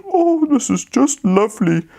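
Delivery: put-on high, cosy little-girl voice; content little girl. Goldilocks voice in story.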